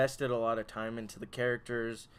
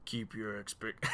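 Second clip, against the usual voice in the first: gravelly biker drawl; 2 semitones lower, 7 dB quieter than usual. Acted. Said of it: "really bad, like, biker impression".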